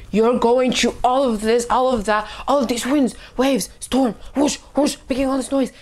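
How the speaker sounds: rising pitch, piling up in bursts; building storm chaos. Shown as a demonstration. Preacher painting winds and waves for listeners, agitated. Acting out the storm's mounting noise.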